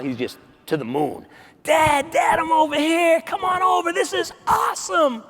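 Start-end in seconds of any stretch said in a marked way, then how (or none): 1.6-5.2 put-on much higher kid's voice calling out across the field; excited kid calling. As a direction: yell to Dad over the grass, thrilled. Boy calls out to his dad.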